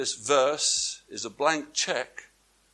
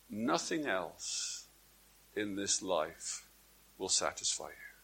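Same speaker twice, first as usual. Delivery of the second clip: solemn hush; 7 semitones lower, 11 dB quieter than usual. Acted. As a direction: let it fall to a near hush. Preacher drops to solemn hush.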